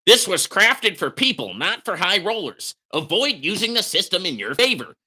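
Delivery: mock bitter reading. Mocking the platform's notice in a bitter, whiny voice. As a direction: read it back like a sulking writer.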